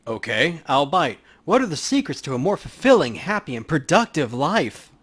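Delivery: mock-serious repeat of his pitch; skeptical tough guy. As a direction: humor the salesman with a smirk. Player character answers recruiter.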